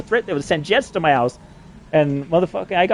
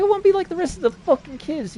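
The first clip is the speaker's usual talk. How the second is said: pitched way up, whiny mockery of a kid; mocking high voice. Mocking the officials' line in a high whiny voice. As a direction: squeak it out like a sulky teen.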